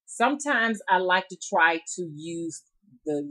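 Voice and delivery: ordinary talk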